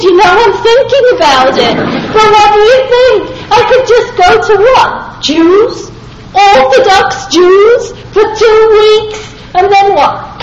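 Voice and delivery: high, loud, laughing disbelief; incredulous. Retold or imitated. Speaker mocking the rabbi's plan, laughing in disbelief. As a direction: toss the questions back, half laughing.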